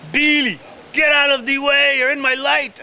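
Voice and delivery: high, loud shout to clear the shot; irritated shout. Retold or imitated. Cinematographer shouting at the actor in his light. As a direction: bark him out of the frame. Cinematographer yelling at him on set.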